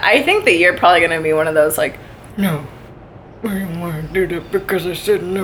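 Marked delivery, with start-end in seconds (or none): 2.4-5.4 put-on low, soft old-man mumble; mumbling old man. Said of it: "What, mumbles?".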